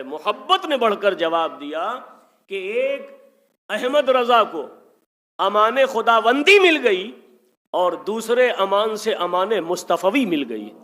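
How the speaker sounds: high, loud climactic declaration; fervent preaching. Real, not acted. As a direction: proclaim it to the crowd. Climax of explanation.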